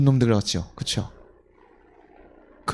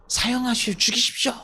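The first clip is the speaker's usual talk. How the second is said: higher-pitched cry of a crowd; mock crowd demand; 9 semitones higher than usual. Crowd answers the high priest, mock demanding cry. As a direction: call it out as an imitated mob.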